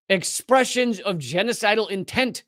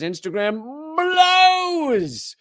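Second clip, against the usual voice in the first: 1 exasperated outburst; 10 semitones higher, much slower than usual. Real, not acted. Ranting about hating Instagram.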